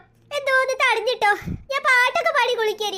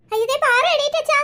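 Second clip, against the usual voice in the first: startled cry; 6 semitones higher, 4 dB louder than usual. Acted. Child sees sister wake.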